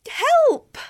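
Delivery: high, loud screech for help. Acted. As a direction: scream for help in terror. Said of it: "screeched the girl".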